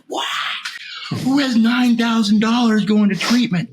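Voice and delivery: incredulous outrage. Retold or imitated. Told to pay nine thousand dollars, outraged disbelief. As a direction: fling the question back at them. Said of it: "I was just losing it".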